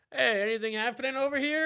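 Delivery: high-pitched, put-on casual cop; clueless cop. Cops at the door an hour late, clueless mock voice. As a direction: sing it out high, like a clueless officer.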